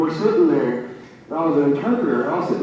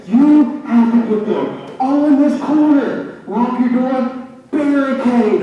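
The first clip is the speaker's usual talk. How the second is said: louder, higher imitation of an insistent principal; principal's firm order; 8 semitones higher, 6 dB louder, slower than usual. Principal instructing panicked Patrick during the drill, mock stern and loud.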